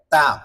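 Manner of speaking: তা said alone, tongue tip at upper tooth roots; a careful dental তা; touch tongue to the upper teeth's base and release তা; demonstrating articulation. Shown as a demonstration. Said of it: "আগা গোড়ার সঙ্গে লাগাই দিতে হবে".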